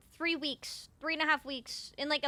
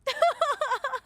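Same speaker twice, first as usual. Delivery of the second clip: high delighted giggle; 12 semitones higher, 8 dB louder than usual. Real, not acted. Delighted by cat.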